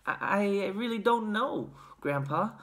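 stammering. A narrator's reading. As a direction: stammer it uncertainly. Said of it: "Charlie stammered".

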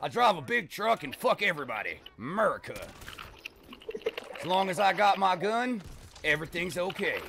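loud, high-pitched American truck-owner caricature; exaggerated American accent. Canadian gamer mocking Americans to teammates, exaggerated American accent. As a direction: boast it in a big American twang. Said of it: "That sounds pretty accurate".

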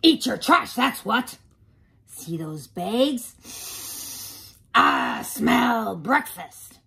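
loud, booming truck character; garbage truck voice. Acted. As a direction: boom it like a hungry truck. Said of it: "garbage truck voice".

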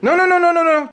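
high, loud rapid-fire "não, não, não"; panicked no. Real, not acted. Friend to friend as the board moves, high panicked protest. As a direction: yelp out a string of "não" in alarm. The planchette starts moving.

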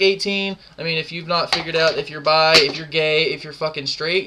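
normal talking voice

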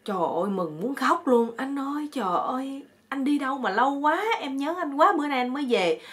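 high-pitched, hammed-up reunion joy; gushing reunion chatter. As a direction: act out an overjoyed couple greeting each other. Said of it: "Hai chồng tao nói líu lo".

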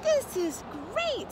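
high, drawn-out thrill; delighted young frog. A narrator's reading. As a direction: marvel high as a little frog in flight. Young frogs love the flight.

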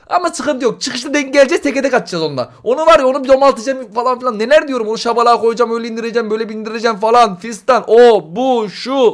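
normal talking voice

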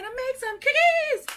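high, bright-eyed hype for the cookies; excited announcement. Real, not acted. Hyping viewers up to make cookies, excited. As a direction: lift it up, thrilled to start.

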